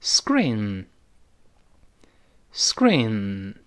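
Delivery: "screen" slow and drawn out as "sgreen"; very light g. Shown as a demonstration. Teacher reads screen for learners to repeat, slowly with soft g. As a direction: stretch "screen", k softened to a light g. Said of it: "特别轻的一个g".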